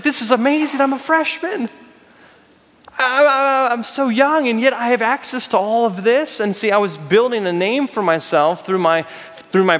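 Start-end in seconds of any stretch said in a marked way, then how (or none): 0.0-6.4 giddy excitement. Retold or imitated. Retelling his own excited thoughts.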